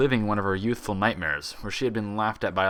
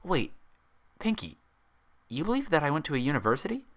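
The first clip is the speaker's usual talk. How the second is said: surprised; 7 semitones higher than usual. A narrator's reading. Twilight surprised question.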